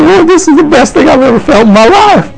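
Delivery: high, overcome voice, as if reliving it; laughing and crying. Retold or imitated. Preacher telling of overwhelming joy in prayer, laughing and crying. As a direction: pour it out high, between laughter and tears. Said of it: "I'm laughing and crying at the same time".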